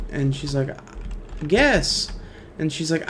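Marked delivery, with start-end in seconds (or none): 1.5-2.1 put-on teasing little girl. Daughter teasing mom in joke.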